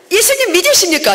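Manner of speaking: put-on eager loud call to the old ladies; eager evangelist call. Repeats the call.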